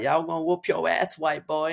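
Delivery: high, squeaky mock Tyson voice; a light mocking impression of a famous boxer; Mike Tyson imitation. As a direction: send up the boxer with a high lisping whine. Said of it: "He's like".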